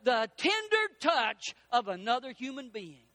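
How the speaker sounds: ordinary talk, nothing marked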